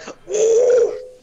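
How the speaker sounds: high, loud imitated shriek; imitated garbled scream. Showing how the scream sounded on my end, high shriek. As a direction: mimic a scream distorted by the mic. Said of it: "it sounded like".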